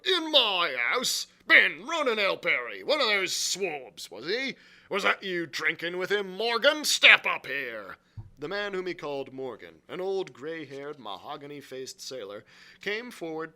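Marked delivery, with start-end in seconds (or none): 0.0-8.0 loud, high barked orders; crying out. A narrator's reading. Silver summons Morgan before the room, crying out. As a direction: rap out orders to the whole tavern. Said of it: "cried Silver".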